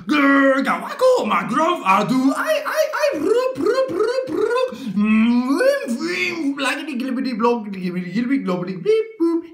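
loud, very high goofy gibberish; a grown-up shouting silly nonsense sounds; wild silly noises. Acted. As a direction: go completely bonkers with the sounds.

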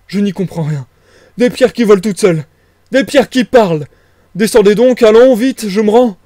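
high, loud frantic exclamations; overwhelmed, shouting in disbelief; bewildered tramp surrendering. Acted. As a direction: cry out baffled, then give in.

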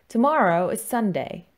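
normal talking voice

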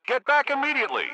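high, loud furious yell; angry shout. Acted. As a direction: bark the order at full volume. Boss yells at empty workplace.

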